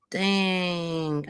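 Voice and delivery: ordinary talk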